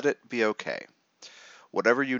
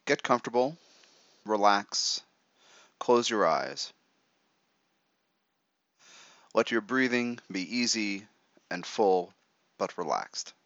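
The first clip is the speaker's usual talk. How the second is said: right in your ear. Acted. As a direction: talk them gently into relaxing. Leads a guided meditation.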